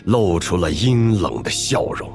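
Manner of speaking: ordinary delivery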